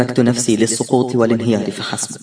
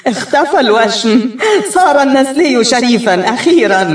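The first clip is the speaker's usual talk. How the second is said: loud laughing; 10 semitones higher, 3 dB louder than usual. Acted. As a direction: crow it to the crowd through laughter. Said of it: "أضحك بصوت عال".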